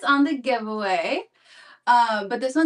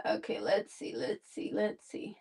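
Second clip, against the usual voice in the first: trailing off; 2 semitones lower, 10 dB quieter than usual. Real, not acted. Stalling while searching, fading.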